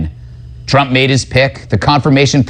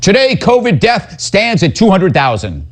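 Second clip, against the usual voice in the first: higher-pitched parody newsreader; mock news read; 6 semitones higher than usual. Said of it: "a little bit of news up front".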